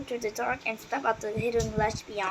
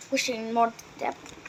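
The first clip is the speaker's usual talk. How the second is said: usual speaking voice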